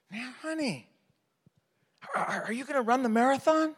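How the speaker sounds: high, coaxing honey voice; butter up his wife gently; a high, sugary husband coaxing his wife; sweet-talking husband. Retold or imitated. Voicing himself sweetly to wife.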